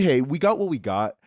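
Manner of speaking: ordinary talk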